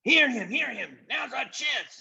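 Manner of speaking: very high, squeaky echoing voices; chorus of eager followers. Acted. Followers echoing their chief, very high and eager. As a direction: parrot the chief like fawning henchmen. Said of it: "a whole chorus of other voices replied".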